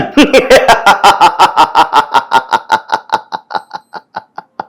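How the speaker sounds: long evil movie-villain laugh. Acted. Villain laughs over his victim. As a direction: cackle like a film villain.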